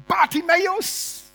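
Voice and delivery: long high call across the room. Acted. Elisabeth calling to Bartimäus across the club, loud. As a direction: call his name loud and drawn out.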